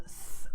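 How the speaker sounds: th as a bare voiceless lisp; th sound. Shown as a demonstration. Teacher isolating the Castilian sound, voiceless th. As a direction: hold th as in English "think". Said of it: "I made this sound here".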